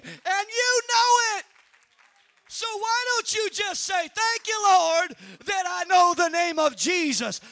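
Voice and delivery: loud, driving sermon delivery; full-force preaching. Acted. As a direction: preach it out to the congregation.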